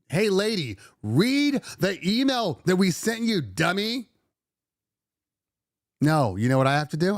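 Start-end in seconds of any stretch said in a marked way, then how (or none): 0.1-4.1 much higher, mocking and slow; snarky put-down. Owner imagining snapping at a client, mocking. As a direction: sneer it at a clueless client. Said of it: "could I be like".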